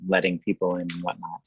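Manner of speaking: ordinary talk, nothing marked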